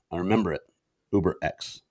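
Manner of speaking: normal talking voice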